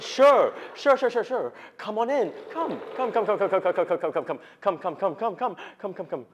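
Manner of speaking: high, excited rapid-fire repetition; eager welcome. Retold or imitated. Man inviting his friend in for a rant, eagerly. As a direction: usher her in eagerly, pitch starting high and tumbling down.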